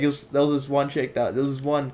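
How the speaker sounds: usual speaking voice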